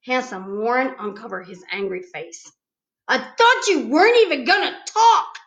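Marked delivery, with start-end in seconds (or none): 3.1-5.4 loud, high holler at her. A narrator's reading. Boy hollering at the girl who won't stop talking. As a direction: yell it out, fed up. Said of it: "he hollered".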